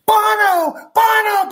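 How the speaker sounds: high, shrieking fan call of the name; hammed-up screaming fans in church; mock fan screams. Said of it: "all the women started screaming".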